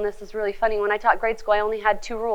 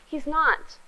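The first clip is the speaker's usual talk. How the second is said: normal talking voice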